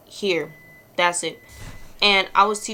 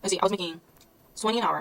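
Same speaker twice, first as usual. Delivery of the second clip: usual speaking voice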